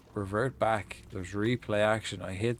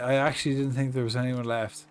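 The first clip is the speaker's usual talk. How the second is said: normal talking voice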